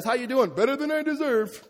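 high, loud stock church reply. Retold or imitated. Christians saying things just because, mock-chipper small talk. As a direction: parody an automatic pious greeting. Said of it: "we say some dumb things sometimes".

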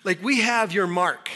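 loud, aggrieved plea to God; complaining people. Retold or imitated. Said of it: "his people have accused God of unfaithfulness".

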